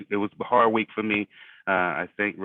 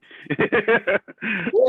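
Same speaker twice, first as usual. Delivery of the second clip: loud laugh; 8 dB louder than usual. Real, not acted. Laughing at Jonas's news.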